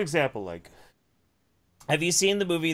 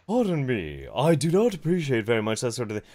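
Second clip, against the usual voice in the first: mock posh objection. Host imitating very posh people complaining, mock posh. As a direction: put on a very posh, polite protest. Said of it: "some people who are very posh would be like".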